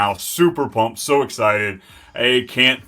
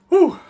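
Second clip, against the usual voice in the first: high whew; 8 dB louder than usual. Real, not acted. Exhale after joke.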